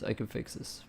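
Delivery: whispered self-reassurance; whispering. Real, not acted. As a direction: say it under your breath. Repeats phrase, then whispers it.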